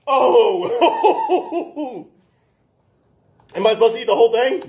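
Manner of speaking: high, laughing through the words. Real, not acted. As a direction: laugh while asking in disbelief. Laughing at chip challenge pain.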